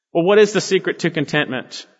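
normal talking voice

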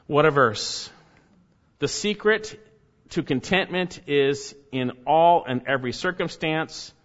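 ordinary talk

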